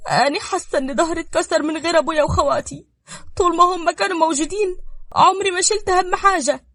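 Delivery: high, distressed wail; tearful lament. Acted. Nada missing her father and brothers, tearful. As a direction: cry out how lost you feel.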